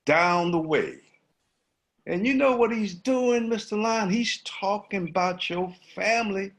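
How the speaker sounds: sly monkey spreading gossip. Acted. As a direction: needle the lion with juicy gossip. Monkey taunting the lion.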